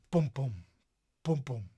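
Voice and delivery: first "boom" strong, second "boom" weaker, like a heartbeat; a mild demo of a strong-weak heartbeat. Shown as a demonstration. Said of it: "the first part is the strong part".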